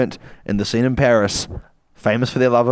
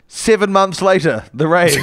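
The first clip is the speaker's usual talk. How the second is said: mock trailer announcer; 7 semitones higher, 5 dB louder than usual. Scene-title announcement.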